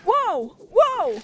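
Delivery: loud, high repeated whoa, real alarm; startled shouts. Real, not acted. Gamer caught in a bomb blast, shouting whoa loud and high. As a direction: yelp whoa each time the blast hits. Surprised by explosion in game.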